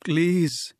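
high, small frightened plea; whispered plea. A narrator's reading. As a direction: plead timidly, pitched high. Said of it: "she whispered".

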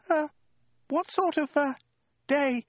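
very high, halting falsetto; hesitant strangled squeak. A narrator's reading. Woman at sword point, hesitant strangled squeak. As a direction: squeak it out in frightened bits. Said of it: "she said in a hesitant, strangled squeak".

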